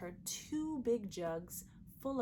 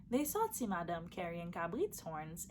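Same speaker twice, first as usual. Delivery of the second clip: ordinary delivery, nothing marked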